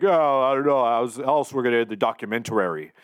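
hint of the Warrior's intensity; casual mock Warrior repeating himself; Ultimate Warrior impression. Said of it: "he's like".

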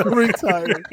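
high laughing shout. Real, not acted. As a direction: laugh through the word. Laughing protest of retiring.

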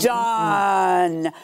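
"done" stretched long and pitched low; drawn-out finality. Real, not acted. Judge declaring the hearing over, long emphatic "done". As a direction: draw out "done" to shut the argument down. Fed-up judge ending the case.

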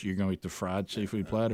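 low, hushed, doubting question; retold disapproving wife. Retells wife's disapproving question.

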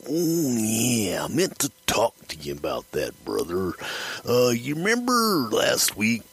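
drawn-out wrestler impression, pitch swooping up; Randy Savage. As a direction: stretch the words out like a stalling wrestler confessing. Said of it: "Randy gets this look on his face like".

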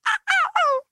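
very high imitated squeals; high-pitched mock "ah" reactions; kids' excited gasps. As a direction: mimic children gasping with delight. Imitating students' squeals at video.

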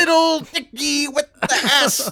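high, slow Cosby voice, laughing; Bill Cosby impression. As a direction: stretch it out like Cosby. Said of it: "can you guys do a Bill Cosby impression".

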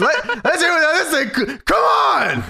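wrestler promo shout. Retold or imitated. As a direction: whip up the crowd. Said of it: "So he says".

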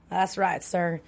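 lightly drawled Southern "That's right, sir"; a hint of a Southern lawman; Southern accent. Co-host mimics a character's Southern accent. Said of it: "he has a really good Southern accent".

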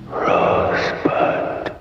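very slow breathy whisper of Rosebud; Orson Welles. Retold or imitated. Host doing a short Orson Welles bit, whispered. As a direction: draw Rosebud out in a dying whisper. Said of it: "My Orson Welles impersonation".

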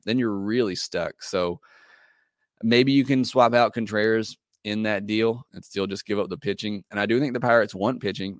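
ordinary talk, nothing marked

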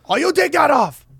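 mock Chinese accent, pitched high; a hammed-up Chinese dad. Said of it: "That was a Chinese accent".

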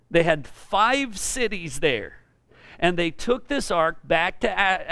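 usual speaking voice

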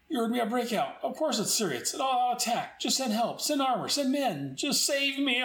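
high, frantic plea for help; panicked officer. Retold or imitated. German officer panics on the radio begging for armor and men. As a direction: beg the radio for rescue. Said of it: "one of the German officers".

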